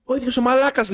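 high-pitched mock disbelief; retold exasperated realisation; incredulous recognition. Man recognising the same peddler in disguise, incredulous.

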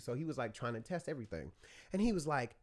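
ordinary talk, nothing marked

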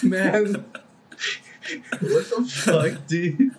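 half-laughing, slow and high; laughing disbelief. Retold or imitated. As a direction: laugh through the words in disbelief.

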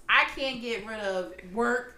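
ordinary talk, nothing marked

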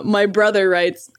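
usual speaking voice

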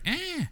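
a short high startled yelp; imitating a startled woman's shriek; mock scream. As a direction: squeal like she's been caught off guard. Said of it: "like, screams".

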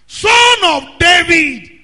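slow loud shout; crying out. Retold or imitated. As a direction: stretch the words and shout. Blind man crying out.

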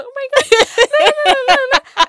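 high, loud panicked wail with laughter; mock panic. Telling siblings about the scary show, imitated loud panic.

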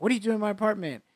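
high-pitched, rapid-fire retold protest. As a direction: blurt it fast and high, imitating someone getting loud. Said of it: "starts to get loud".

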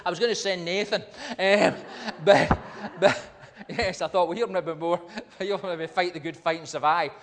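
loud, high, laughing through the joke. Real, not acted. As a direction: joke aloud while breaking into laughter. Joking about his imagined death.

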